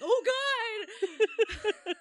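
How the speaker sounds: high, loud jolted yelp; startled awake. Retold or imitated. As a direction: snap awake in alarm.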